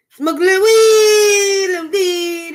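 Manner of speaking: loud, high, drawn-out whoop on WEED; slow, belted playful cheer; gleeful holler. Pretend. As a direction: stretch WEED into a long gleeful yell. Draws out a mock-celebratory list item.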